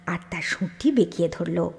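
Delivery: ordinary delivery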